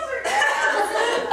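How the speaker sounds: loud, high laughing fit; big high laugh; laughter. Real, not acted. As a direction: burst out laughing.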